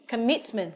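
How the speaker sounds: "commitment" đọc nhẹ, nhấn âm tiết "mit"; lướt "com", trọng âm vào "mit" trong "commitment"; trọng âm hai. Shown as a demonstration. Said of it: "commitment có trọng âm hai". (nhấn "mit" trong "commitment")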